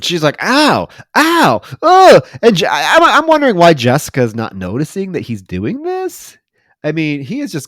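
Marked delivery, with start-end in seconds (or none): 0.4-2.3 high, loud mock cries of pain; hammed-up groans, pitched way up; imitated pained moaning. Retelling a dental patient's agony, moaning and groaning. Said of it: "like, moaning and groaning".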